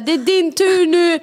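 high, slow, drawn-out demand; exasperated. Retold or imitated. Tired mother telling partner it is his turn, exasperated. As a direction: insist on it, stretching each word. Retold nagging at partner to get up.

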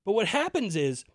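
ordinary delivery

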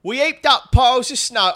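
loud, high South London woman; first try at a South London voice; South London accent. Acted. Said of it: "you do the voice for her".

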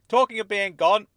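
high, loud cutting in; quick interruption. A narrator's reading. Dennis cutting in on Miss Angel, hasty and loud. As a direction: jump in to change the subject. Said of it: "Dennis cut in quickly".

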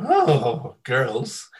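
mock-smitten starship captain; lecherous Kirk. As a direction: swoon over the girls, hammed up. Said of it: "he'd sort of go".

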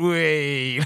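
drawn-out mock "wee" of glee; teasing whoop. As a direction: whoop like a prankster whisking a hand away. Imitating Liam's teasing hand-pull.

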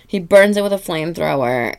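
ordinary talk, nothing marked

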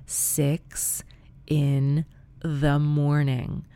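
very slow, word by word; dragged out for comic effect; drawn-out disbelief. Real, not acted. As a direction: stretch out each word for weary emphasis. Stressing how late it went on.

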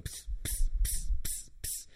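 short unvoiced pss pss of a pump; pump hiss imitation. Mimicking the pump in the tent, breathy pss. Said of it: "She's got this penis pump going".

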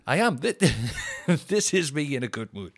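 ordinary talk

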